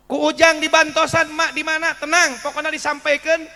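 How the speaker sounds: calm her down firmly; young man reassuring the lost granny. Acted. Ujang reassures, louder.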